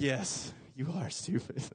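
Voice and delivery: smug quiet gloat. Retold or imitated. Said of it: "I was like".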